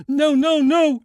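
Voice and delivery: high, loud sobbing cries; grieving girl sobbing over her dying friend. Acted. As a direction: break down crying the words. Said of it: "she sobbed".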